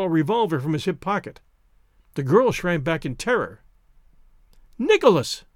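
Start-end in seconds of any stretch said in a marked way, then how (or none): 4.8-5.4 higher, louder alarmed call; crying out. A narrator's reading. Frightened woman calls to the man drawing a revolver, crying out. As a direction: call his name in alarm. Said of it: "she cried".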